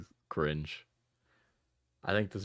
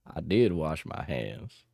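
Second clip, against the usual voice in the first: slow Texas drawl; Texas accent; 4 semitones lower than usual. Pretend. Streamer insisting in a Texas accent. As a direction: drawl it like a cowpoke. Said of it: "cowboy accent line".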